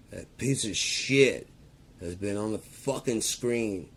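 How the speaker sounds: high, loud, slow contempt; furious rant. Real, not acted. Ranting at Trump on TV, furious. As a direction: spit it out with disgust.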